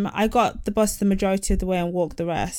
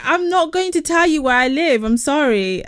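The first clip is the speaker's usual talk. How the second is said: indignant refusal; 6 semitones higher, 3 dB louder than usual. Retold or imitated. Retells firmly refusing a stranger.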